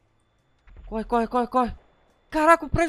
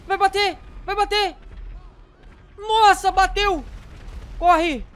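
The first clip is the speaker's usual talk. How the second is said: high-pitched, urgent commentary; alarmed excitement; 7 semitones higher than usual. Real, not acted. Player watching a plane about to crash, alarmed excitement. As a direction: call it out high and panicky.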